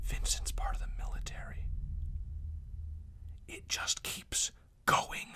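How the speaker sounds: slow conspiratorial whisper. Real, not acted. Streamer whispering a conspiracy theory to viewers, whisper. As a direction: breathe it out like a secret.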